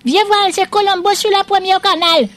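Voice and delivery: high, eager call out; excited call. Acted. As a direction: shout for the boy to come see. Appelle, excitée par Colombo. (appel excité, voix très aiguë)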